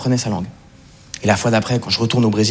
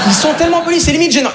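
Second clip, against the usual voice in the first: mock gushing; 14 semitones higher than usual. Comic exaggeration of politeness.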